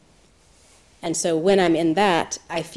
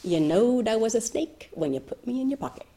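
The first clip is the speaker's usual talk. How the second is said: smug snake; 5 semitones higher than usual. Acted. Snake's punchline in story.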